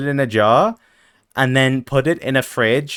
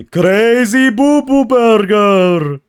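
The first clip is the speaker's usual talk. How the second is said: silly drawn-out voice; 8 semitones higher, 6 dB louder, much slower than usual. Pretend. Slow high silly voice.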